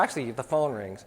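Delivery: ordinary talk, nothing marked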